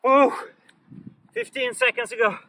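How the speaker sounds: out of breath, loud and strained; panting through the words after exercise; after a workout. Real, not acted. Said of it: "My legs are burning".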